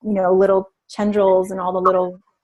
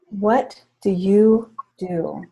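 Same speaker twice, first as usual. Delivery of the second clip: slow gentle question; much slower than usual. Retold or imitated. Coach asking again after the breathing, slow and gentle. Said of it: "all right, hold on".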